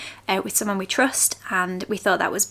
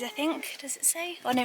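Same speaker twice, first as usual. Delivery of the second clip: normal talking voice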